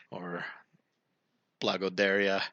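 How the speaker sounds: usual speaking voice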